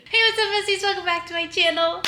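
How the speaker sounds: high, loud, bubbly hello to viewers; excited greeting. Real, not acted. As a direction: pump up the channel intro. YouTube channel intro greeting.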